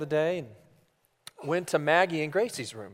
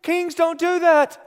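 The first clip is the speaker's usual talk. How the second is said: put-on very high little-girl voice, correcting; pitched-up child laying down the rules; little daughter; 8 semitones higher, 3 dB louder than usual. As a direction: imitate a bossy small child instructing her dad. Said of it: "And she says".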